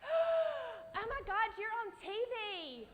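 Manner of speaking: very high, loud starstruck squeal. Retold or imitated. Seeing Tim Gunn at the audition, very high starstruck squeal. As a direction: gush like a fan meeting a star. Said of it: "is the first thing I said".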